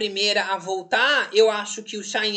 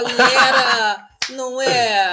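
mock enthusiasm; 5 dB louder than usual. Mocking him, loud and far.

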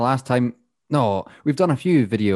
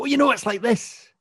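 much higher, animated Scottish lilt; passionate Scottish accent; 12 semitones higher than usual. Shown as a demonstration. Showing how they speak when passionate, high-pitched Scottish accent. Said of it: "when I speak passionately about something".

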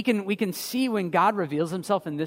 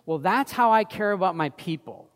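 normal talking voice

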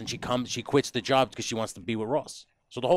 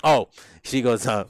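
normal talking voice

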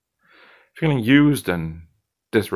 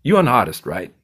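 cocky bartender Zed; 3 semitones higher than usual. Acted. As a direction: needle him like the slick bartender.

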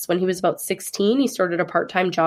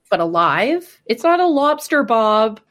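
exasperated; 3 semitones higher, 5 dB louder, slower than usual. Real, not acted. Outraged at boiling duck alive.

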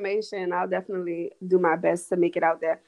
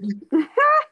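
high burst of laughter; 13 semitones higher, 7 dB louder than usual. Real, not acted. Guest laughs at the remark.

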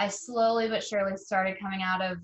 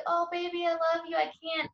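tender; 8 semitones higher than usual. Retold or imitated. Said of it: "I would talk back to her being like".

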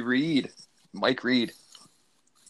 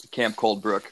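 ordinary talk, nothing marked

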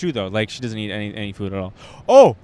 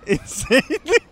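high, slow, stretched-out disbelief; drawn-out amazed exclamation; 12 semitones higher, much slower than usual. Retold or imitated. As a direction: marvel at how insane it is. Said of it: "And I was like".

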